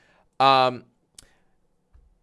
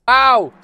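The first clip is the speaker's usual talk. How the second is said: short loud yelp of pain; sharp ow; 10 semitones higher, 11 dB louder than usual. Real, not acted. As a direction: yelp as if hit. Sudden pain.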